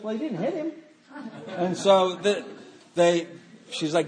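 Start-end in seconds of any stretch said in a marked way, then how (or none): 0.0-0.7 high, softer dismissive reply; imitated grandmother. Grandmother answering her yelling daughter, high mock unconcern. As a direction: put on a high-voiced, unbothered grandmother.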